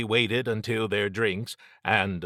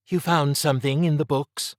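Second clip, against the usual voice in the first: higher, louder questioning voice; raised character voice, inquiring; Trish asking; 6 semitones higher, 5 dB louder than usual. A narrator's reading.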